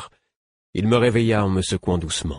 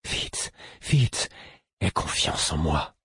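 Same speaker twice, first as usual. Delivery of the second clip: pleading; 4 dB quieter, slower than usual. A narrator's reading. Fool urging his patient to trust him, pleading. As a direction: beg softly for quick trust.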